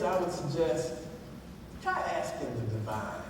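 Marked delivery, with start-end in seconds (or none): 1.8-3.2 slow punchline. Real, not acted. Punchline of the sermon joke.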